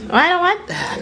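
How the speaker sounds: high-pitched mock refusal; voiced toddler. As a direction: refuse in a high little-kid voice. Voicing the child refusing food.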